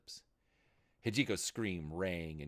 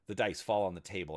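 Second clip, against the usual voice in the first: plain reading voice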